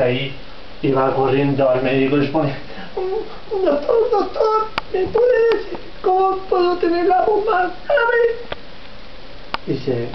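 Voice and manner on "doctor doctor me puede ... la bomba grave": very high pitch. Acted. Giant pleads to doctor.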